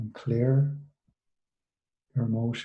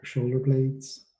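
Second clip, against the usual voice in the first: soothing meditation guide, unhurried and hushed; guided meditation. Acted. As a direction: ease them gently through the body scan. Leads a guided body-scan meditation.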